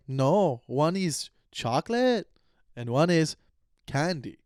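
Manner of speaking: slow, drawn-out mock Minnesota accent; hammed-up, unsure Minnesota accent; attempted Minnesota accent. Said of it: "I don't know what accent that was".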